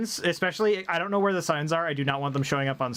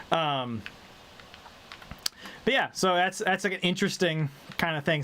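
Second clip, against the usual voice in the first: normal talking voice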